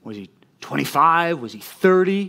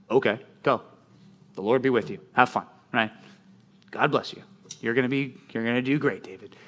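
soft, dismissive, half-hearted blessing; eye-rolling send-off; 3 semitones lower, 5 dB quieter than usual. Retold or imitated. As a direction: wave him off with a lazy eye roll. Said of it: "with rolling his eyes in the back of his head".

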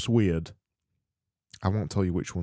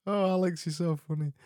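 amused sigh; 9 semitones higher, 6 dB quieter than usual. Real, not acted. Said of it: "got a laugh out of me".

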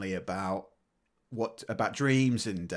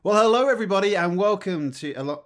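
podcast host intro; 8 semitones higher, 4 dB louder than usual. Shown as a demonstration. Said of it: "I've done it again".

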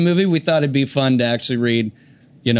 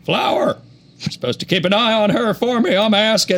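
Bill Clinton; 6 semitones higher than usual. Acted. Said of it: "play this role as Bill Clinton".

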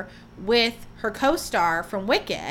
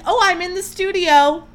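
higher and louder, playfully dismissive; mock nonchalance; 8 semitones higher, 6 dB louder than usual. Host imitating the star shrugging off the scandal, higher and louder. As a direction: toss it off like nothing's wrong. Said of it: "she's like".

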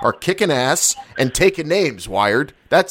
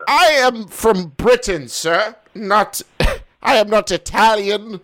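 hammed-up British gentleman, higher; mock British accent; 7 semitones higher than usual. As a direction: insist you are British, slightly higher voice. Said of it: "your Italian accent".

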